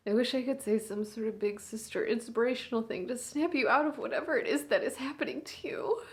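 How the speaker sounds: a big sister at a hospital bedside, swinging between hushed and raw; hushed, aching bedside monologue; grieving big sister. Acted. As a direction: speak to your unconscious little sister, holding back tears. Sister talks to comatose sibling.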